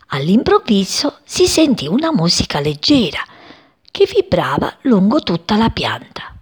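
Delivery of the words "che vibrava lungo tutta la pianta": ordinary delivery, nothing marked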